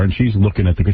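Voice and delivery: ordinary talk, nothing marked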